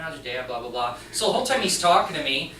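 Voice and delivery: ordinary talk, nothing marked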